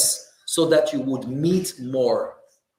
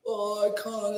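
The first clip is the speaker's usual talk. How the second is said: mock whine, pitched higher; whiny complaint; 5 semitones higher, 5 dB quieter than usual. Preacher mocking a fasting man who moans. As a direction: imitate a grown man moaning. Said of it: "when you talk like that".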